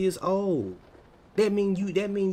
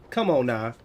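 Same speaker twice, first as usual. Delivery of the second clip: ordinary talk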